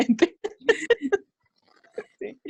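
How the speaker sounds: ordinary delivery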